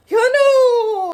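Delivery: loud, high drawn-out call. Pretend. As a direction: wail the name out, long and loud. Calls out to absent teacher.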